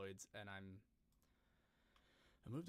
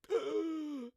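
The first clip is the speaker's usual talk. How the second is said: sharp, very high, loud gasp for air; a cartoonish gulp of air, as if he forgot to breathe; 11 dB louder than usual. Cartoon hero gasping after forgetting to breathe, hammed-up loud gasp. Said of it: "And he gasps for air after".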